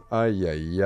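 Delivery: normal talking voice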